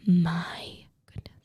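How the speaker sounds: slow, whispered gasp of admiration; swooning whisper. Real, not acted. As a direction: draw it out in a whisper. Swooning over an attractive swimmer.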